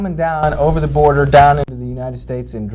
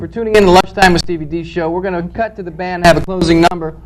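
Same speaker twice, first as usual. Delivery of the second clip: cheesy talk show host closing the show; a hammed-up TV host's goodbye; host sign-off; 3 semitones higher, 5 dB louder than usual. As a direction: announce it to the audience.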